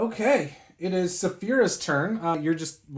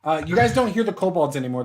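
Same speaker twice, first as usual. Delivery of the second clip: ordinary delivery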